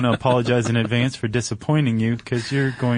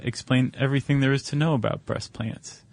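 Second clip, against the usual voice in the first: ordinary talk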